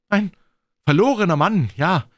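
high, loud, doubting retort; suspicious. Acted. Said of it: "entgegnete argwöhnisch der andere". (argwöhnisch, mit hoher Stimme)

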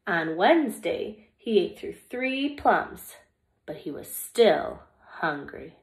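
lively, expressive storybook reading; reading to children. Acted. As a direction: read it like story time for little kids. Reads picture book to children.

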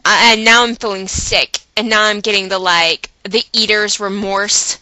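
ordinary delivery

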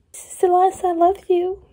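high, swooning mock romance line; mocking the book's couple; mock lovesick voice. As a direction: parody the lovesick hero. Mocking book characters' romance.

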